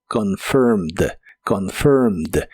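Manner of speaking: "confirmed" slowly, ending in a bare D with no E; low, slow model of "confirmed"; only D pronounced. Shown as a demonstration. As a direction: say "confirmed" as two syllables, con-FIRMD. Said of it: "solo se pronuncia la D".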